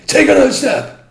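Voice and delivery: high, very loud barked order; police shout. Retold or imitated. Officer ordering the landlord to step back, very loud high shout. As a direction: bellow it as a command.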